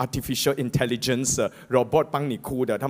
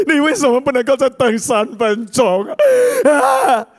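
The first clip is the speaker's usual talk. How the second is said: high, loud mock crying and lament; hammed-up grieving relatives who can't wait; wailing mourners; 13 semitones higher, 7 dB louder than usual. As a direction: sob out the complaint in a hammed-up way. Acting wailing mourners again.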